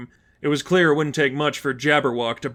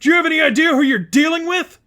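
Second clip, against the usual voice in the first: much higher, louder, fast furious yell; furious shout; 6 dB louder, faster than usual. Acted. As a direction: rant as the suit overloads. Overloading villain rants.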